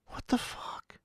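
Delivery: hushed, voiceless disbelief; stunned whisper. Acted. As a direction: whisper it as if blown away. Stunned aside about early closing.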